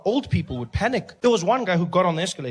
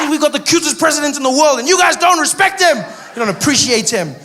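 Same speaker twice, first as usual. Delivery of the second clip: much louder and higher, laughing; mock scolding; 8 semitones higher, 9 dB louder than usual. Real, not acted. Comic berating the audience for disrespecting the president, louder and higher. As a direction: scold the crowd in playful outrage.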